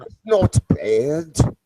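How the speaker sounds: slow, drawn-out Kiwi "not bad"; man practising a Kiwi accent with his friend, slow careful try. Shown as a demonstration. Slow attempt at New Zealand "bad". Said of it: "I've gotta get the word bad right".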